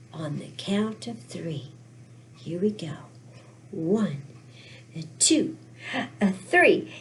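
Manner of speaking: low, soft, suspenseful count; hushed countdown. Pretend. Storyteller counting the audience in, hushed anticipation. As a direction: count off quietly, building slightly to three.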